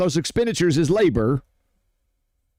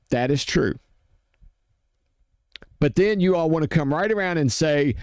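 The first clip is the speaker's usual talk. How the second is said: ordinary talk, nothing marked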